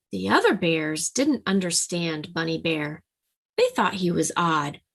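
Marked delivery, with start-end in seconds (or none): none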